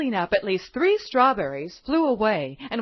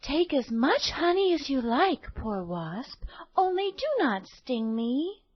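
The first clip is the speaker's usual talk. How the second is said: put-on high, sweet little girl asking kindly; 5 semitones higher, 3 dB quieter than usual. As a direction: play a gentle young girl.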